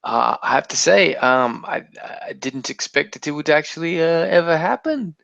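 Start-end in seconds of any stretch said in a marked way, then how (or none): none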